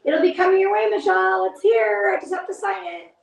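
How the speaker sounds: high, fast excited call out; bubbling over to tell her it's coming; excited to Michelle. Real, not acted.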